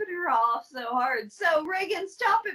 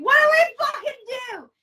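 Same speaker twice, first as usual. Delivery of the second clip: high, loud, fast panicked yell; mock panicked scream; 8 semitones higher, 6 dB louder, faster than usual. Officials in the Situation Room, mock panicked scream.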